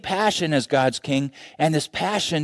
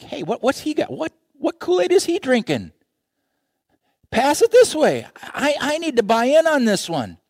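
high, curious and eager; leaning in, wanting what he has; eager onlookers; 12 semitones higher than usual. Acted. Onlookers asking to join in, high and eager.

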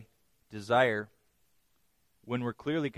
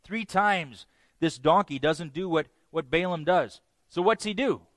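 ordinary talk

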